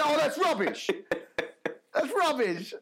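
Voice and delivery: high laughing moan; mock disgruntled colleague. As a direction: moan it like a sore loser, laughing. Colleague losing the bet.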